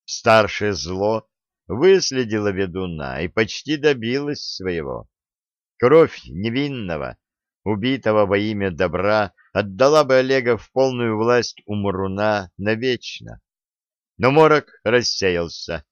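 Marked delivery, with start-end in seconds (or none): none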